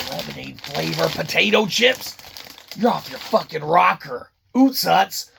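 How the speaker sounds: usual speaking voice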